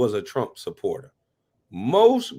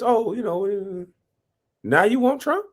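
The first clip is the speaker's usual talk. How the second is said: mocking quote; 6 semitones higher than usual. Mocking flip-floppers.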